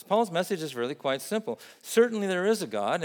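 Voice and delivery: ordinary talk, nothing marked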